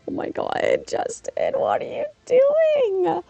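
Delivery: high, drawn-out exasperated squeal at the end; hammed-up disbelief, stretching the last word; mock dismay. Reacting to coworker's wrestling speech, in high mock dismay. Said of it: "And I'm just like".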